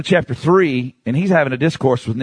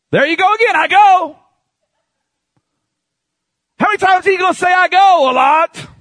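high, forceful pulpit cry; fiery preaching; 5 dB louder than usual. Acted. Preacher hammering Jesus's words to the congregation, fiery. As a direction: preach it out, pitched high and driven.

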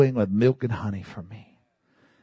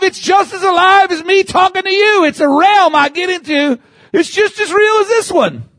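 preaching at full force; 8 dB louder than usual. Real, not acted. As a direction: cry it out to the congregation.

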